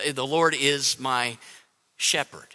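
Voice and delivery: low and soft, with a pause before "shepherd"; hushed lead-in, then "shepherd" set apart; stress on SHEPHERD. Shown as a demonstration. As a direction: let "shepherd" land quietly after a beat. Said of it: "not my dictator".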